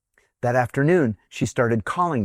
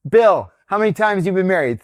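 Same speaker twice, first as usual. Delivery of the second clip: higher, louder retold call to a colleague; 7 semitones higher, 5 dB louder than usual. Boss polling colleagues about marriages, calling out. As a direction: hit the name hard, then rattle the question.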